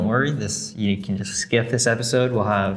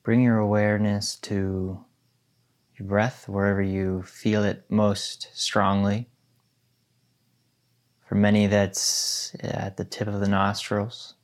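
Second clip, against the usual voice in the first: guided meditation. Acted. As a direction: guide them gently into stillness.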